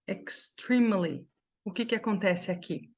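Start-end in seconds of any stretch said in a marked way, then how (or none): 0.1-1.3 "extremely" slowly, first E short, "eks-TREEM-uh-lee"; slow pronunciation model. Shown as a demonstration. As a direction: draw out "extremely" with a long I in the stressed syllable. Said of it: "Essa palavra vai ficar assim".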